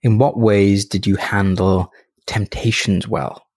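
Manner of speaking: unhurried guided reflection, leaving space after each phrase; guided meditation. Acted. Podcast host guiding listeners through end-of-day review, calm meditation voice.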